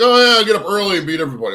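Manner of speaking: mock your own gung-ho plan, fast and loud; mock eagerness. Mocks own eager early-rising plan.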